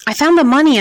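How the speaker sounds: high, loud desperate shout. Acted. Slapped boy shouts his confession at the interrogators, high and loud. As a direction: yell the confession out. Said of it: "I shouted".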